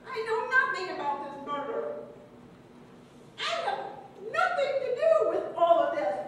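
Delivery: high, loud protest of innocence; worked-up suspect's protest; emphatic denial. Acted. Suspect denies involvement when questioned.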